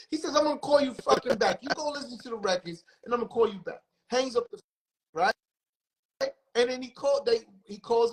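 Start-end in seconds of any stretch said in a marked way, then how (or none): 0.4-3.8 high-pitched heated scolding; retold angry boss. Label boss chewing out his executives, heated and high. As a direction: tell them off and slam the call. Jimmy berating execs, retold.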